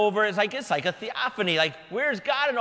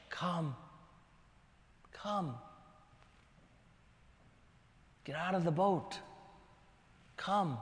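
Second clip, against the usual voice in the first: soft, slow, gentle call; Jesus calling Peter gently over the storm; 2 semitones lower, 12 dB quieter than usual. Retold or imitated. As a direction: invite him out tenderly. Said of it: "And Jesus says".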